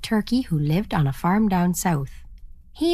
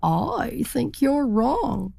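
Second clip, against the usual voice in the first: slow, higher, drawn-out insistence; stubbornly disagree, taking your time; stubborn turkey; 4 semitones higher, much slower than usual. Acted. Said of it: "said the turkey".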